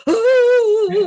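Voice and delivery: high, loud crying wail; ghostly wail. Retold or imitated. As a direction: wail all night long. They kept hearing it.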